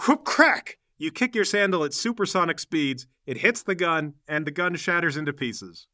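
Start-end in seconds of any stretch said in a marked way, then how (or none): 0.1-0.8 high, loud whoosh and crack; imitated impact. Game master voices sandal hitting gun, explosive. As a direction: voice the sound effect sharply.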